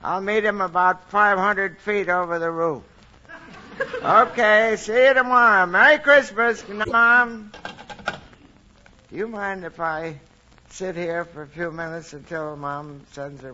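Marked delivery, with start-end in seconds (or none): 0.0-7.5 higher and louder, shouting down the line; cheery call over a long line; long distance phone call. Acted. Santa phones Mom at North Pole.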